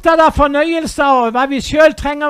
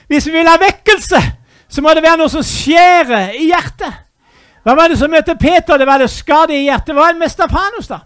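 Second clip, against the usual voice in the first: fiery preaching; 4 semitones higher, 6 dB louder than usual. Acted. Preacher pleading with the congregation for revival, loud. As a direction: cry out the plea for revival.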